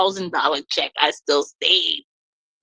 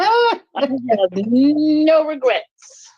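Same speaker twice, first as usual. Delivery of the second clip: drawn out very slowly and high; slow, savoring; 8 semitones higher, much slower than usual. Real, not acted. Wife tells friends about the divorce, slow and high. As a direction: savor every word, no regrets. Celebrating leaving husband.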